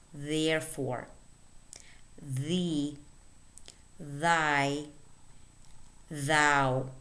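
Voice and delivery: single words held out, evenly spaced with long pauses; listen-and-repeat word reading. Shown as a demonstration. Teacher reading voiced th words for learners, careful and separated. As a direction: read each word carefully as a model. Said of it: "let's read the words".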